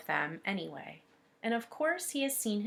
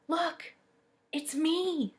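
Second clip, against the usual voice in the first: put-on eager little boy, pitched higher and louder; first-grader showing off his paper to the teacher, bursting with excitement; excited boy; 7 semitones higher, 4 dB louder, slower than usual. Teacher reads the boy's line, pitched up with eager excitement. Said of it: "He rushes up ... shoves the paper".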